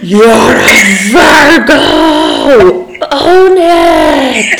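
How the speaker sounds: drawn-out slow mock shout; hammed-up cheer, slow; slow, playful yell at a friend; mock shouted insistence. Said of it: "You were like".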